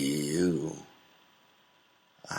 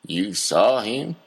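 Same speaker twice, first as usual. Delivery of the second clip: higher, louder, rising in shock; startled question; 6 semitones higher, 7 dB louder than usual. Acted. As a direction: jolt up in disbelief. Startled reply.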